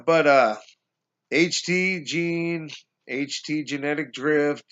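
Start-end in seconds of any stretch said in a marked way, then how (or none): none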